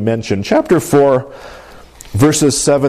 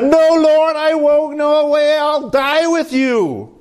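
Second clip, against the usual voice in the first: high, drawn-out vow of loyalty; hammed-up boastful disciple; proud Peter; 13 semitones higher, slower than usual. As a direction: declare it high and sustained, full of bravado. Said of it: "I'm sure he said in his pride".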